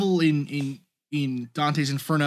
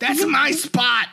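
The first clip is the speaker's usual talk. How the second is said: high, drawn-out mock whine; mock indignant complaint; 9 semitones higher, 4 dB louder, slower than usual. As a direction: play an outraged parking-spot owner, much higher. Mimics the devil grumbling about parking.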